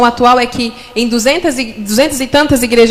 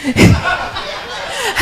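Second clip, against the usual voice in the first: laughing; 10 semitones higher, 5 dB quieter than usual. Real, not acted. Laughing at own fear.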